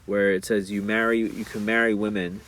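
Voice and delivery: usual speaking voice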